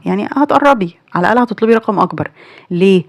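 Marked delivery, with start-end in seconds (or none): none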